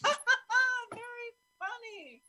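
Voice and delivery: high mocking ha ha ha, then a dry very funny; a high, sneering fake laugh aimed at the voice; sarcastic fake laugh.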